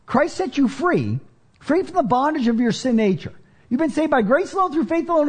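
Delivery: high, rising preacher's exhortation; preaching at full force. Acted. Preacher urging his church, rising in passion. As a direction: drive the point home, climbing.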